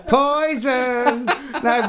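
Poison! shouted with a laugh to mean the actual poison, not the band; emphatic exclamation. Acted. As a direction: shout the word Poison to clear up the mix-up. Insisting it's poison, not the band.

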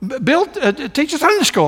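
very high imitation Scottish accent, stumbling; little Scottish accent. Scottish church member talking up Sunday school, imitated Scottish accent. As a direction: put on a high-pitched Scottish voice, halting. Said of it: "has a little Scottish accent".

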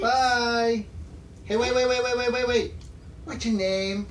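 put-on high, loud kid shouting; child calling out. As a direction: call after them like a kid.

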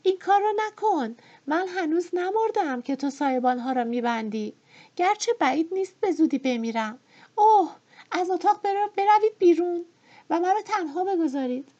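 furious cry from the bed; shouting. A narrator's reading. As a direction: scream them out of the room. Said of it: "فریاد زد".

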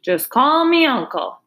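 hearty, lightly voiced big pig. A narrator's reading. Uncle the pig answers Charlotte, big and hearty. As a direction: boom it out good-naturedly. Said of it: "he said in a big, hearty voice".

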